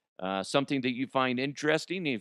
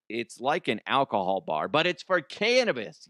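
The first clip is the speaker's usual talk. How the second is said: ordinary talk, nothing marked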